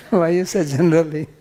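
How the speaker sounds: teasing through laughter; laughing question. Real, not acted. Teacher laughing as he teases the student about his word. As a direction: chuckle while you tease him.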